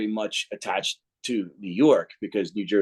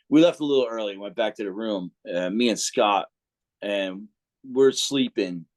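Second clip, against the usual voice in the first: normal talking voice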